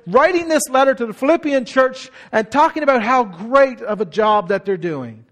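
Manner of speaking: ordinary talk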